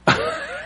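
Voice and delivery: short high burst of laughter; risa aguda. Real, not acted. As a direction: laugh in disbelief at the image. Taxista ve elefante pidiendo parada. (risa breve y aguda)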